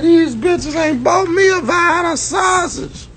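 high, slow, hammed-up shout; mock whiny shout. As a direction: shout it like the drunk friend. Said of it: "He said".

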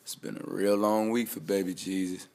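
ordinary talk, nothing marked

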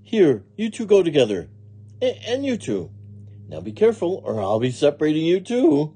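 high, chirpy scolding of little charges; playful literal-minded maid. Acted. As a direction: fuss over them in a high voice. Maid talks playfully to vegetables.

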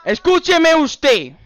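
high, loud, drawn-out order; stern demand. Pretend. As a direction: cut in and demand attention as the officer. Fake officer demanding attention. (orden a gritos, alargada)

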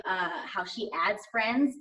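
ordinary delivery

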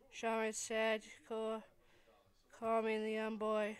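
ordinary delivery, nothing marked

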